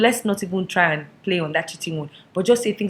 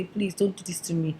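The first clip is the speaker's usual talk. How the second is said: ordinary delivery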